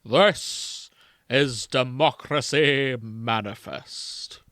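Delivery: grand, drawn-out proclamation; quoting arrested Australian. Retold or imitated. Quoting an arrested man's speech to jog a friend's memory, slow declamation. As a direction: declaim it very slowly, word by word. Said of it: "I'm gonna quote from him".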